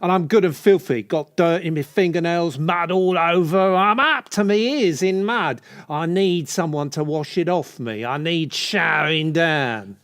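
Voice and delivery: hammed-up, suggestive builder. Builder flirting with a client about mud, hammed-up. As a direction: lay the mucky builder act on thick. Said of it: "I'm a builder".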